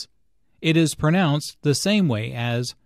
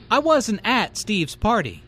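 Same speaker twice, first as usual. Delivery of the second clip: "I wasn't AT Steve's party"; "at" stressed to mean not there at all; 7 semitones higher than usual. Shown as a demonstration. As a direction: punch the word "at", pitched up. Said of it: "the emphasis here is on at".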